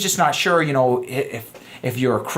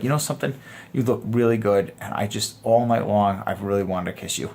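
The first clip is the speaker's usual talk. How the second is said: sincere confession; 5 semitones lower, 3 dB quieter than usual. Shown as a demonstration. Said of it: "You're being honest with your intentions".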